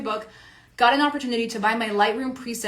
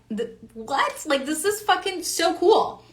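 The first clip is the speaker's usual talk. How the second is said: amazed delight; 6 semitones higher, slower than usual. Retold or imitated. To readers sharing their results, higher slow amazed delight. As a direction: stammer then a higher, slow wondering exclaim. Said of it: "and I'm like".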